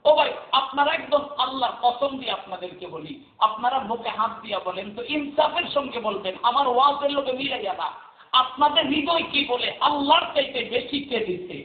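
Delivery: high, ringing preacher's call to the crowd; full-force preaching. Acted. Preacher rousing the gathering to answer him, in full-force chant. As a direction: lift the crowd, chanting each line high.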